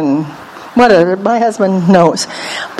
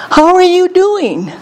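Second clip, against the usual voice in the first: friendly greeting; 6 semitones higher than usual. Retold or imitated. Preacher retelling her hello to a lady, friendly. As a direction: greet her with a big lilting fall.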